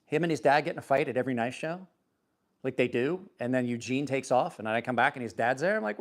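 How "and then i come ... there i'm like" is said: ordinary delivery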